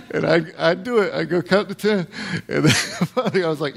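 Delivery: usual speaking voice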